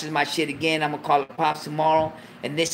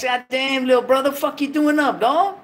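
much higher, surprised big-brother call; high-pitched quoted viewer; 9 semitones higher than usual. Retold or imitated. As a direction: read the comment up high, amused. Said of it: "Arturo X".

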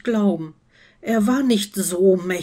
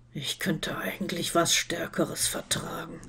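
whispered grumble, much softer; grumbling; 2 semitones lower, 8 dB quieter than usual. A narrator's reading. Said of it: "brummte Harry". (leises Brummen)

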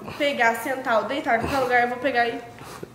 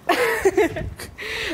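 burst of laughter; 10 semitones higher than usual. Real, not acted. Laughing at the dog.

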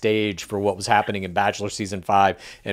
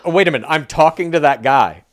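louder, higher dawning surprise; sudden realization; 5 semitones higher, 8 dB louder than usual. Retold or imitated. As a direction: act out the fan's penny dropping. Imagining the fan's realization.

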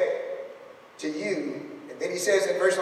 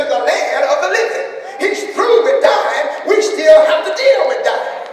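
rising, louder preacher's cry, higher on dying; preaching at full force; 5 semitones higher, 5 dB louder than usual. Real, not acted. Pastor crying out to Larry's mourners, full-force preaching. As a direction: build it up and ring it out.

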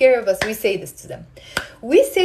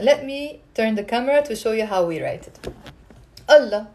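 normal talking voice

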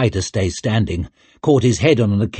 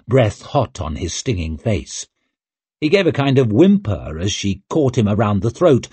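usual speaking voice

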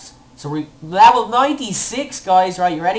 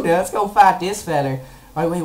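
ordinary delivery

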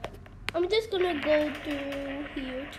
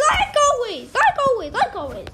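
frustrated; 6 semitones higher, 7 dB louder than usual. Real, not acted. Frustrated after dying.

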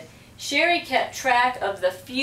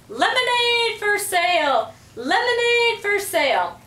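parrot squawk; 11 semitones higher than usual. A narrator's reading. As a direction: squawk it out like a parrot selling lemonade. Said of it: "Petey squawked".

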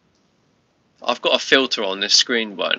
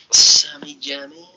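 higher, louder and drawn out, teasing fun; greeting a viewer with a silly rhyming nickname, sing-song; playful sing-song nickname; 5 semitones higher, 8 dB louder, slower than usual. Real, not acted.

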